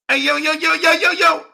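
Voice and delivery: very high squealing mimicry of rivals; mock critics. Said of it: "that's how they sound".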